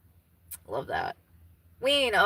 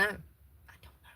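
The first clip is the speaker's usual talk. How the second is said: slow breathy whisper echoing herself; hushed second take; whispered repeat; 13 dB quieter, slower than usual. Real, not acted. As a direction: let it fade to a whisper.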